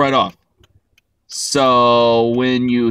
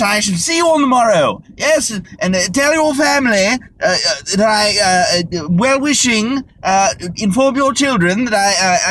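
posh British farewell; 6 semitones higher than usual. Retold or imitated. A European dragging out a goodbye to an American, hammed-up posh British. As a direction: ham up an endless polite goodbye. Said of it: "when you're trying to say goodbye".

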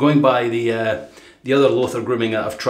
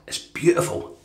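breathy sigh of delight; 7 dB quieter than usual. Real, not acted. Sighing over the soap's scent.

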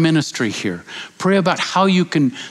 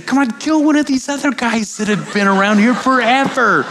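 panicked plea; 9 semitones higher than usual. Retold or imitated. Panicked plea while being attacked.

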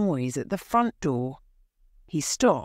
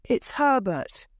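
high, urgent cry of hope; a mother sure her son is back; excited cry; 6 semitones higher than usual. A narrator's reading. Wife cries to husband, high and urgent. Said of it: "she cried".

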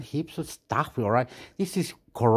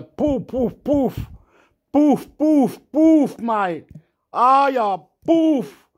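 higher, louder hollered poofs; excited yelling; 9 semitones higher, 8 dB louder, much slower than usual. Real, not acted. Gamer hollering at the game, higher and louder. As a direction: shout each poof out.

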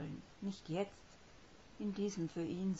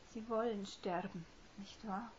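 choked voice; 5 semitones higher, 4 dB quieter than usual. Acted. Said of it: "klang ihre Stimme so erstickt".

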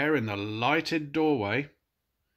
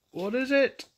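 startled gasp; 4 dB louder than usual. Acted. Fergus startled by shadow.

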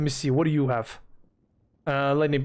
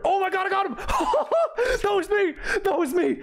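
ecstatic laughing. Real, not acted. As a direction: shriek with joy and laughter. Wins the fight.

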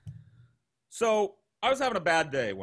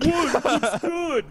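mock defensive roommate; 10 semitones higher, 4 dB louder, much slower than usual. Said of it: "He was like".

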